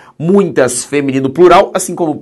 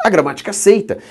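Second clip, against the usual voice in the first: usual speaking voice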